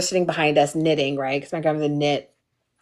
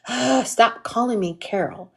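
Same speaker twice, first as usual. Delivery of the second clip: mock annoyance; 3 semitones higher, 3 dB quieter than usual. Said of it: "she would always fake like she didn't like it".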